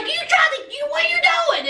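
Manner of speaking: high, stumbling mock alarm; alarmed outcry. Onlookers alarmed at the gun.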